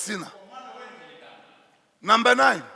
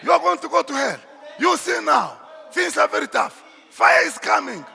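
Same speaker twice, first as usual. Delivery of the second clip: imitated hellfire pastor, raised pitch and volume; rebuking; 3 semitones higher, 3 dB louder than usual. Preacher imitating a pastor threatening the congregation, rebuking. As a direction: warn them forcefully, in separate punchy bursts. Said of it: "and then rebukes you".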